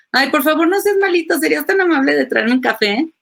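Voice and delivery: high, sweet and fast, begging with a smile; sugary pleading request. Retold or imitated. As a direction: plead sweetly like asking a favor. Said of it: "entonces le dices". (ruego meloso y agudo, con una sonrisa)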